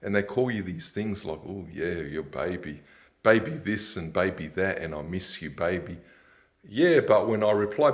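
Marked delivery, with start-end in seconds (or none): 1.5-6.0 soft, low mock baby talk; mock sweet talk. Mocking Instagram women cooing at him softly. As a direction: coo it lightly, mocking.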